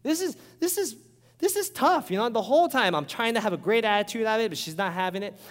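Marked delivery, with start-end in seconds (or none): 0.0-2.1 exasperated complaint. Retold or imitated. Pastor imitating himself fed up at his fiancee in the gym. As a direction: voice climbing much higher in mock exasperation. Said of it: "And I'm like".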